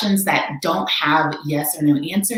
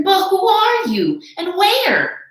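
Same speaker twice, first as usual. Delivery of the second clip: much higher, slow searching call; Horton calling; 13 semitones higher, 4 dB louder, slower than usual. Acted. Horton searches for the speck, high slow call. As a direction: call out into the air. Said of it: "said Horton".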